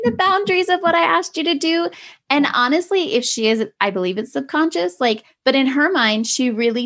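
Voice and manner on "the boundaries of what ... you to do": high, incredulous retold question; incredulous parent, pitched up; mock exasperation. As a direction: push the question up in exasperated disbelief. Exasperated mother retelling her challenge.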